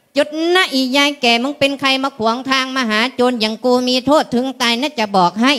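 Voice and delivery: ordinary delivery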